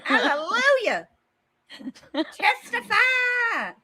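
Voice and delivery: high slow preaching shout; church preacher. Retold or imitated. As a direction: call out like a gospel preacher. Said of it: "religious ceremony".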